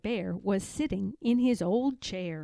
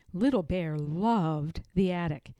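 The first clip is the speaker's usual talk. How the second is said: ordinary delivery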